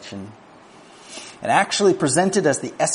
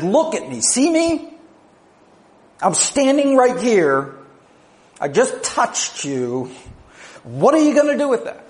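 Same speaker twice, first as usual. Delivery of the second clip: much higher and louder, fast by the end; urgent insistence; 10 semitones higher, 3 dB louder than usual. Acted. Jesus confronting John after touching him, high urgent insistence. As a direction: press him, demanding a response.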